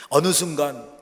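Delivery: normal talking voice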